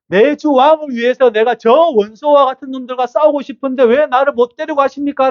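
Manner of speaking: high, urgent protest; pressing the king to let him fight; David protesting. Acted. 다윗이 왕에게 싸우게 해달라고 높은 목소리로 항변. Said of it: "라고 항변하는 거예요".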